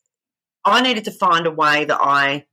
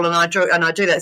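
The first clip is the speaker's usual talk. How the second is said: normal talking voice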